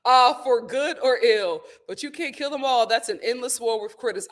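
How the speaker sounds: ordinary talk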